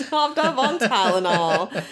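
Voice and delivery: laughing though it hurts; laughing through pain. Real, not acted. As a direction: giggle through the back pain. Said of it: "It hurts to laugh that much".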